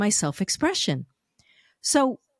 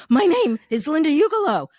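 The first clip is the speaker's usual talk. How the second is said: high, mock-panicked introduction; a little panicked; 6 semitones higher, 4 dB louder than usual. Shown as a demonstration. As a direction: pitch it up in acted panic. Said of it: "you can be a little panicked like".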